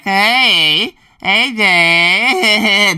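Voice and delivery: loud, high, drawn-out teasing hey; mocking greeting. Coworkers greeting someone who has already quit, mocking. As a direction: imitate coworkers jeering in singsong. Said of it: "They were kinda, like, mocking me".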